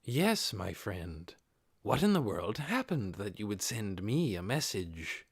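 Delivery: deep, calm and courteous demon voice; unhurried, composed, a touch amused; deep calm Demiurge. Acted. Demiurge answers his friend Cocytus's message, deep and calm. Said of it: "Demiurge's deep, calm voice".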